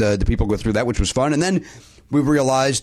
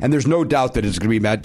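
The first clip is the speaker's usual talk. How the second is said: normal talking voice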